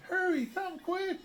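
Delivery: high pleading call; urgent scared plea. A narrator's reading. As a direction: beg them to come fast. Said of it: "she cried".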